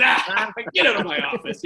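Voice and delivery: imitating the coach laughing as he talks; laughing quote. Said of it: "he just starts laughing".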